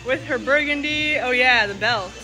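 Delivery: ordinary talk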